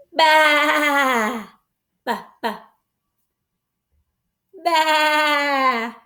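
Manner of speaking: bleating baas, last one called out; playful mock bleat; lamb baa. Shown as a demonstration. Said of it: "Can you baa like a lamb?".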